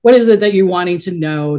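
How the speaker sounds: usual speaking voice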